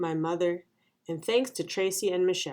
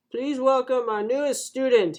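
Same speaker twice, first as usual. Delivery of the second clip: higher, projected classroom introduction; welcoming teacher; 6 semitones higher, 4 dB louder than usual. A narrator's reading. Teacher introduces the new girl to class, welcoming. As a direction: present a newcomer to the class.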